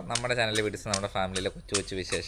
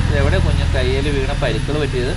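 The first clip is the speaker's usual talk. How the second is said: alarmed question; 2 semitones higher, 8 dB louder than usual. Real, not acted. Mother spots the baby's scraped hand, loud and alarmed. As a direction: call out in alarm about the wound.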